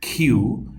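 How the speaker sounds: "কিউ" with aspirated kh; slow rising "khiu"; corrected pronunciation. Shown as a demonstration. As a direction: puff the k and stretch "কিউ" upward. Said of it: "এটা হচ্ছে কিউ".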